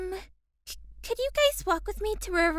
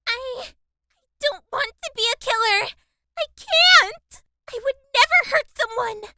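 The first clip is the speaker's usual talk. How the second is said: panicked denial; 6 semitones higher, 7 dB louder than usual. Acted. Kaori panics about being a killer.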